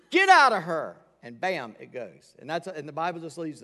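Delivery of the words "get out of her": loud rebuke, full force; shouted command to the spirit. Retold or imitated. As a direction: throw the words at the demon like an order. Paul casting out the demon.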